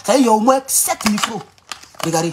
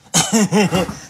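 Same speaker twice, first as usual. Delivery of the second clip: self-satisfied chuckle; 2 semitones lower than usual. Real, not acted. Streamer chuckles before getting to the preamble, chuckling. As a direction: chuckle to yourself between sentences. Chuckles after mocking opponents.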